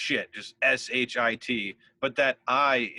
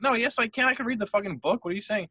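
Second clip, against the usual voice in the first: indignant retort; 9 semitones higher, faster than usual. Retold or imitated. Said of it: "I'm like".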